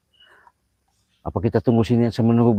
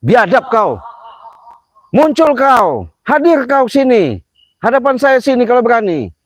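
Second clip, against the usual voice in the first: much higher and louder, a yelled taunt; calling out the unseen coward; furious shout; 7 dB louder than usual. Real, not acted. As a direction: bellow a challenge into the dark. Shouting a challenge at spirits.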